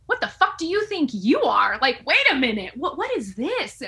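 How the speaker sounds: loud, high, indignant comeback; retold angry outburst. Woman retells snapping back at boyfriend's insult, loud indignation. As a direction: punch it out in acted outrage.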